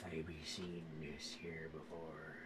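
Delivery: put-on low, quiet old sea dog; old sailor. GM voices old sailor cook.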